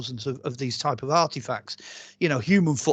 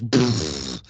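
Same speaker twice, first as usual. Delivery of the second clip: low lip-buzz brrr like a brain stalling; deep buzzing mock shutdown noise; mock brain-freeze brrr; 11 semitones lower than usual. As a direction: do a deep dismissive brrr as your head gives up. Head shutting down at huge dates.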